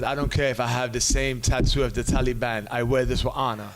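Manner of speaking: put-on a weak, low Russian accent; bad Russian accent. Quoting a wild guy's tweet in a bad Russian accent. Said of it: "a Russian accent. My accent sucks".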